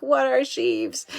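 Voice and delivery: slow, high puzzled question; puzzled viewer. Retold or imitated. Imitating a confused viewer asking her what sheaves are, slow and high. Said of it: "you're probably like".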